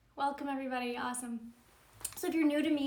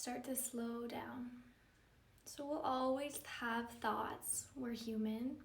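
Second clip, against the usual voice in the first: softer, unhurried meditation voice with long pauses; calm, measured yoga-teacher meditation delivery; guided meditation; 6 dB quieter than usual. Acted. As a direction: guide them gently into stillness, leaving space between phrases.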